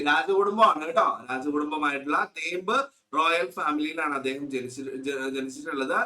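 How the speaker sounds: ordinary talk, nothing marked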